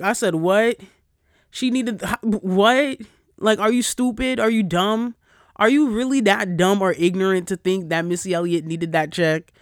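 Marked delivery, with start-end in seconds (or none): 0.4-3.0 loud, high, outraged 'what?'; retold indignant double take; incredulous. Retold incredulous reply in quarrel. 3.4-9.5 loud, heated questioning; retold outraged scolding; indignant retort. Retold angry retort in quarrel.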